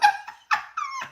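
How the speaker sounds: sharp high laugh burst; laughing as the trolls show up. Real, not acted. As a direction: squeal with laughter.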